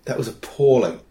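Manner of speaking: usual speaking voice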